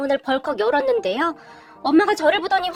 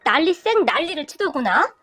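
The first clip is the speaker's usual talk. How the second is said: ordinary delivery, nothing marked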